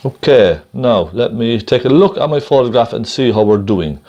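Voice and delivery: ordinary delivery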